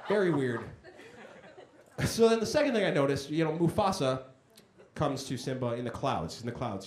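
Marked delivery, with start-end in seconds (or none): none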